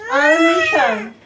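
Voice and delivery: high, slow announcement; starting the prank, a high drawn-out "our mission"; mock dramatic. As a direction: stretch it out high like a big reveal.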